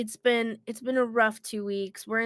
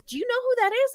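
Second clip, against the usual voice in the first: quoting stranger, high; 13 semitones higher, 3 dB louder, faster than usual. Retold or imitated. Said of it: "And he goes".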